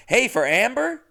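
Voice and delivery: high, loud questioning hail; perky driver hello; chirpy retold greeting. Retelling own cheery pickup greeting.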